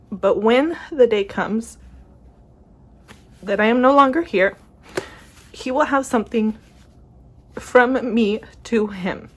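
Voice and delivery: heartfelt, with long pauses to hold it together; real emotion. Real, not acted. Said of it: "I was gonna get emotional".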